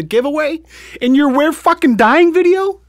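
high, loud incredulous shout; outraged shout. Real, not acted. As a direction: yell it in disbelief. Outrage peaks at giveaway.

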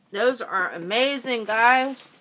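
talking while chewing; words round a bite of food; mouth full. Real, not acted. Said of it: "Sorry I'm eating on camera".

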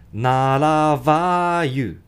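"ならばyou" with the T as a flapped R. Shown as a demonstration. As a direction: say "not about" as "ならば". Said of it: "ならばなんですね".

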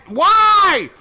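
loud, high exasperated yell; exasperated gamer outburst; frustrated cry. Real, not acted. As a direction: cry out in protest. Frustrated at enemy spawns.

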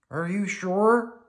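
high, slow, worried question; disbelieving puppet voice; Bert. A narrator's reading. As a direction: draw out the question, pitch rising. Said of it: "Bert asked".

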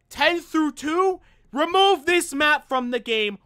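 high and loud, dragging it out; fed-up complaint at full volume; exasperated rant. Real, not acted. Ranting about the hated map.

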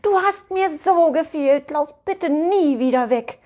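high, emotional mistress voice; high-voiced, affectionate pleading; heartfelt owner's greeting. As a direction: welcome a missed pet home, pitched high. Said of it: "von seinem Frauchen herzlich begrüßt".

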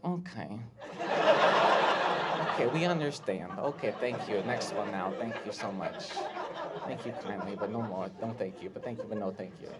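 soft, low, unimpressed thanks; politely dismissive crowd. Retold or imitated. Crowd politely dismissing the comedian, quiet low brush-off. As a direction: murmur it, trailing down to wave the act away. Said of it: "when the crowd's like".

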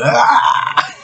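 sudden high mock scream; imitated scream. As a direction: yelp it out high, like the guy in the clip. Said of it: "this other dude is going".

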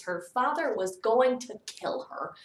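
usual speaking voice

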